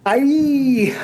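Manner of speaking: ordinary delivery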